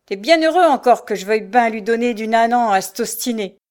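loud, grudging bluster; rough scolding. A narrator's reading. Mother shoves her son downstairs, scolding.

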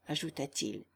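ordinary delivery

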